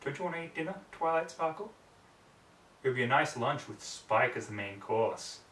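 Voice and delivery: usual speaking voice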